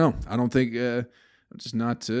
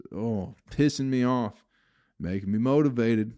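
usual speaking voice

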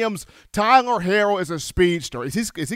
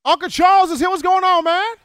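excited greeting; 11 semitones higher, 5 dB louder than usual. Real, not acted. Host greets favorite caller.

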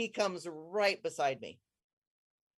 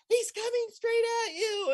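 high, loud yelled warning; retold scream; 3 dB louder than usual. People on the beach warning the swimmer, a high yell. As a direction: imitate the crowd shouting a warning. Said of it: "the people on the beach start screaming".

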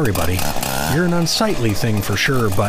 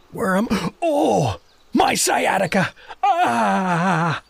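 pained cry; 5 semitones higher, slower than usual. Acted. Doug waking in pain.

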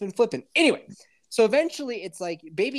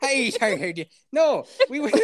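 mock leprechaun Irish accent; hammed-up Irish accent; 6 semitones higher than usual. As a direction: ham up an Irish accent for a friend. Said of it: "I'll show you Irish".

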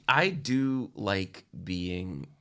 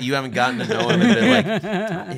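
laughing; 10 semitones higher, 6 dB louder than usual. Real, not acted. Laughing while teasing.

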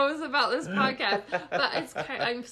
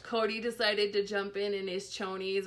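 usual speaking voice

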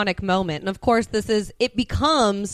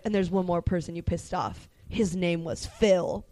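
solemn hushed; 5 semitones lower, 8 dB quieter than usual. Retold or imitated. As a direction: say it softly like mourning a friend. Retold solemn Phil line.

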